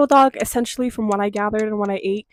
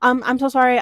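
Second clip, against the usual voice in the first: overly polite apology; 4 semitones higher than usual. Retold or imitated. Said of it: "I was like".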